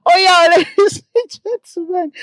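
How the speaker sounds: high, giggly outburst; laughing. Real, not acted. Vlogger cracking up at her own joke, laughing. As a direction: talk through high-pitched laughter.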